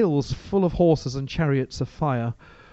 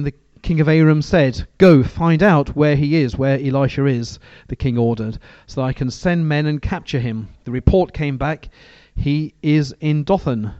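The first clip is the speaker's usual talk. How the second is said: steady, louder reading of the Bible passage; scripture reading; 4 dB louder than usual. Acted. Preacher reads scripture aloud to the church, measured and steady. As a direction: read the verses out to the congregation with weight.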